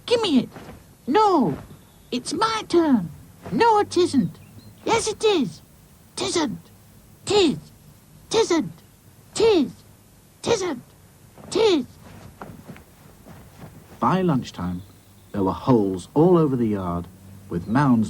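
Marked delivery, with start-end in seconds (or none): 0.0-11.9 very high bratty back-and-forth; squabbling boys. Acted. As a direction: bicker in short childish squeals. Two boys squabbling over spade.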